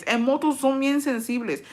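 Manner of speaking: usual speaking voice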